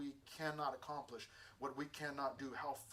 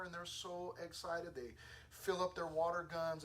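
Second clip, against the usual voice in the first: normal talking voice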